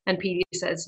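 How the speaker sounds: ordinary delivery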